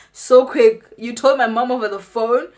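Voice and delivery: loud, high, incredulous question; shocked disbelief. Retold or imitated. Shocked the aunt told mom.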